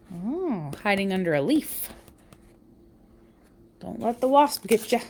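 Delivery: ordinary delivery, nothing marked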